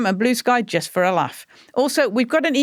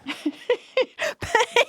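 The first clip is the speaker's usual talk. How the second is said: high, soft, breathy laughter; 12 semitones higher, 4 dB quieter than usual. Real, not acted. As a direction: laugh it out quietly. Host keeps laughing.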